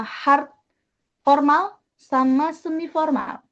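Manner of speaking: ordinary delivery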